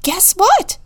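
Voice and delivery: high, loud bunny shout; thrilled little bunny calling out; excited shout. A narrator's reading. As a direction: shout it, bursting with news. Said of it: "shouted Rosie".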